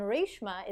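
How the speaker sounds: Reshma said as RAYSH-ma; correct heritage pronunciation. Shown as a demonstration. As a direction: give Reshma a long "ay" vowel, RAYSH-muh. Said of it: "the way that it's technically supposed to be said".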